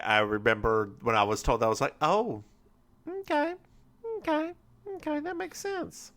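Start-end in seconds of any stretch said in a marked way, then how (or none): 2.0-6.1 tiny high, hushed voice; squeaky soft mock acceptance; meek acceptance. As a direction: imitate a small, quiet "oh, okay". Retelling own reaction on being told.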